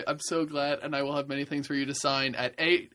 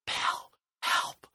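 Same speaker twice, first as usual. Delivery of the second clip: slow urgent whisper; slower than usual. Acted. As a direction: hiss a plea for help. Whispered plea while being watched.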